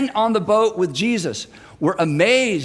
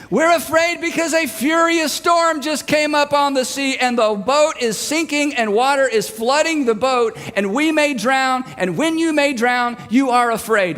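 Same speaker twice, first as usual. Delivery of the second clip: exasperated yell; 6 semitones higher than usual. Retold or imitated. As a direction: yell the obvious answer back. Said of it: "Everyone is yelling".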